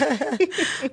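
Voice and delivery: high, loud laugh; real high laughter; burst of laughter. Real, not acted.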